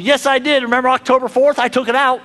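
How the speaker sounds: a hint of a child arguing back; child defending himself to the parent; defensive child. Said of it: "your child responds to you".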